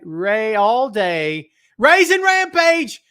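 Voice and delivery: drawn-out, high, booming announcer intro; slow, high, loud wrestling-announcer hype; ring announcer call. Pretend. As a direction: announce him like he's walking to the ring. Hyping a chatter's ring name.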